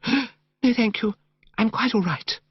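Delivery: put-on high, breathless girlish gush, slowly savoured; gushing, dreamy young woman. Narrator voicing Madeline to Bertie in the park, high dreamy gush. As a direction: coo it like a sentimental girl.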